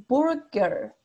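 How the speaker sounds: burger slowly, both R sounds clearly rolled into the vowel; slow rhotic burger, American English. Shown as a demonstration. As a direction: burger the US way, the R marked at the end. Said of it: "Ahí marcan la R".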